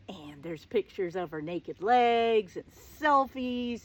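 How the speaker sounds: slow scandalized whisper. Real, not acted. Telling the audience about his phone, in a slow whisper. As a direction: hush it like a shocking secret.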